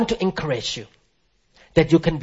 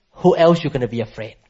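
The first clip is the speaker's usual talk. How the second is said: ordinary delivery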